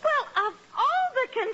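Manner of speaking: high, outraged splutter; exasperated outburst. Acted. Wife outraged at husband's conceit, high and spluttering. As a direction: sputter at his vanity, cut off mid-insult. Peg outraged at Riley's vanity.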